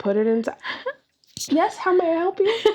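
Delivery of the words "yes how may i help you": bright polite shop clerk greeting; chirpy help-desk hello; customer service voice. Pretend. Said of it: "My customer service voice".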